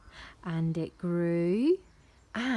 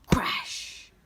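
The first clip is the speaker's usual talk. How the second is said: whispered, explosive crash; crash landing. A narrator's reading. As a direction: make the word a noisy impact. Imitating the giant's landing.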